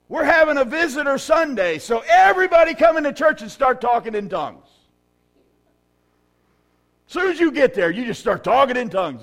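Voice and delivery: high, loud preaching; rally the church like an announcement; full-force preaching. Acted. Pastor mocking Corinthian church, loud preaching.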